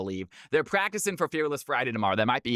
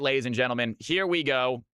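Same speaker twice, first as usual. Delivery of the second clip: ordinary talk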